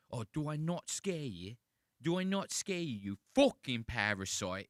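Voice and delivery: high-pitched British bully parody; mock British accent. Imitating a British man in a little kid's face, mock British accent. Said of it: "So I see a British guy like this".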